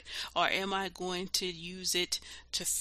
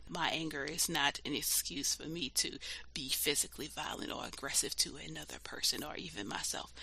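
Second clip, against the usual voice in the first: normal talking voice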